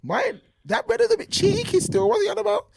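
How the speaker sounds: high, squeaky mock reaction voice; a cartoonish high pitched aside. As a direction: jump way up high in a mock surprised voice. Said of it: "So I'm like".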